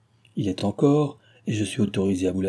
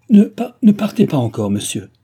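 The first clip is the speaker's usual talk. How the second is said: much higher, much louder stammered cry; agitated young woman begs her guardian to stay; stammering plea; 6 semitones higher, 9 dB louder than usual. A narrator's reading. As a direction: trip over the first word, desperate to stop him. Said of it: "s'écria-t-elle en balbutiant".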